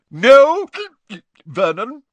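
high, loud objection broken by hiccups; hiccuping, tipsy. A narrator's reading. Aunt stopping uncle sending boy off, hiccuping. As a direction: hiccup through a drunken protest. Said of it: "hiccuped Aunt Marge".

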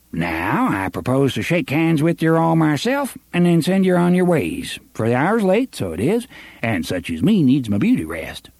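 high, wheedling small-town sheriff; oily official buttering up the boys; pompous sheriff voice. Acted. Sheriff's genial farewell.

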